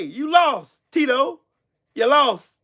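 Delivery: ordinary delivery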